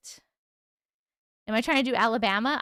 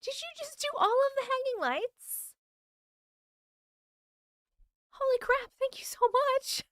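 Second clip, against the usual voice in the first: much higher, gushing thank-you; excited thanks; 12 semitones higher than usual. Real, not acted. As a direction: squeal your gratitude to the viewer. Thrilled thanks for gifted lights.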